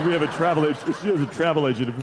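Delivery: low, strained, swallowing words; holding in a burp. Retold or imitated. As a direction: push words past a burp. Muscling through burp.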